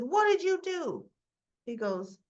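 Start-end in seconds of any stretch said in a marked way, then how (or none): 0.0-1.1 angry demand. Retold or imitated. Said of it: "And Sonny said".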